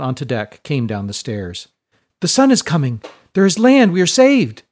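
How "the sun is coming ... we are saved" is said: excited shout. A narrator's reading. Sailor calling down the stairs with joyful shouting. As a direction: voice jumps high and loud with relief.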